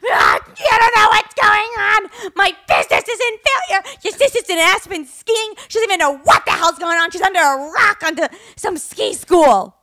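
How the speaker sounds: high, wailing mock rant; imitating mom yelling. Daughter mimics mom ranting about business and sister, yelling. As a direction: rant loudly like a hysterical mother. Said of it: "she's trying to yell at me".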